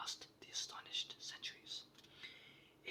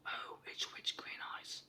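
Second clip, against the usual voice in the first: ordinary delivery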